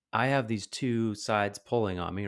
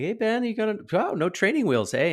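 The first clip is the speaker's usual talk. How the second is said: much higher and louder, delighted; cheery praise to a kid; 12 semitones higher, 5 dB louder than usual. Retold or imitated. Walkers praise Ben riding without training wheels, high delighted voice. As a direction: hype up the little boy's no-training-wheels ride.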